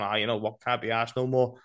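ordinary talk, nothing marked

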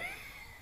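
short high laugh; real laugh. Real, not acted. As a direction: burst out laughing mid-rant. Laughing at [name]'s mom lyrics.

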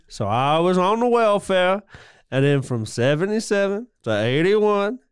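put-on slow, high, drawn-out old man voice. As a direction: draw every word out like an old man. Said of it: "As a very old man".

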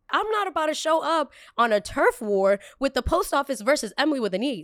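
high, loud, emphatic protest; indignant refusal. Retold or imitated. Acts out refusing to show up.

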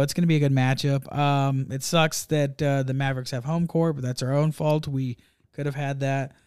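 ordinary talk

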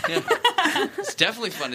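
laughing through the answer. Real, not acted. Host asks if it was fun, laughing reply. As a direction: giggle it out, amused. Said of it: "Was it fun?".

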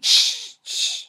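long unvoiced hush; insistent shushing. Child hushing Dad again, shushing. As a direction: hiss for silence.

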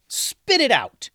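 high, loud impatient yell; angry yell. A narrator's reading. Tatiana yelling at the pet shop owners, high angry shout. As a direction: bark it at them, demanding. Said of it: "yelled Tatiana".

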